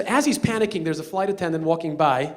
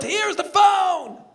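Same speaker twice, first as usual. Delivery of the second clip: slow, high, loud call up to the cockpit; 14 semitones higher, 6 dB louder, much slower than usual. Retold or imitated. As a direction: shout it up to the pilot, stretching the words. Driver calling up to pilot.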